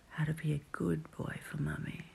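ordinary talk

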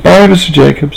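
put-on high, bright child's goodbye; Sally cheerfully saying goodbye to Mr Jacobs; cheery little girl. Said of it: "Sally said cheerily".

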